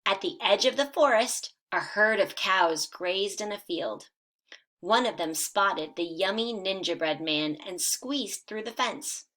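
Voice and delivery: lively storybook read-aloud for first graders; animated children's story narration. A narrator's reading. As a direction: read it like a bedtime picture book, bright and animated. Teacher reads picture book aloud.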